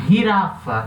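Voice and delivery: girafa said hirafa; Spanish j as h. Shown as a demonstration. Teacher showing Spanish jirafa. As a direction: girafa with a raspy h. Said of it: "vai ter esse som de, de R".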